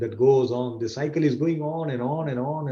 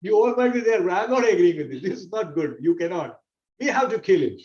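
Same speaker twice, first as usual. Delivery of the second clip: higher, forceful objecting voice; defiant Bhim; 8 semitones higher than usual. Acted. Teacher voicing Bhim refusing his family's agreement. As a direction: refuse like a stubborn warrior. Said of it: "Bhim says".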